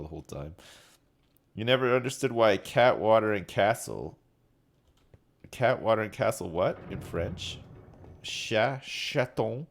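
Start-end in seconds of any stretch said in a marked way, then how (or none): none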